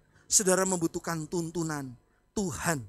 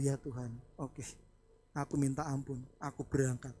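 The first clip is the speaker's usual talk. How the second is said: much softer, lower contrite reply; meek surrender; 6 semitones lower, 6 dB quieter than usual. Retold or imitated. Pastor giving in to God's rebuke, soft and contrite. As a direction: give in humbly, almost under your breath. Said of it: "Waktu saya dengar gitu".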